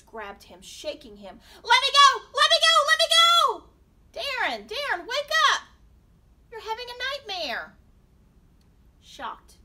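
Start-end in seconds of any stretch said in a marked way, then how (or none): 1.6-3.7 high, full-volume struggle; panicked screaming. A narrator's reading. Struggling against hands in nightmare. 4.1-7.8 loud, high calling out; urgent waking call. A narrator's reading. Parent waking a screaming son.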